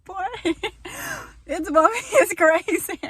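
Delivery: very high, loud laughing squeal. Retold or imitated. Imitating herself laughing at a friend. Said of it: "Be like".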